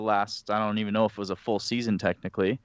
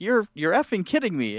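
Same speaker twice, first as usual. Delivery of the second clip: higher-voiced mock astonishment as a stunned dad; retold disbelief; 8 semitones higher than usual. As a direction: imitate dad's disbelief, pitched higher. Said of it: "he drops an F-bomb".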